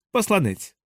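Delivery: a curt one-word call. A narrator's reading. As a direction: announce it sharply, falling at the end. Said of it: "сказав він різко".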